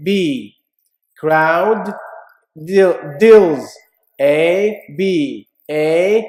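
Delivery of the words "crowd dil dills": ordinary talk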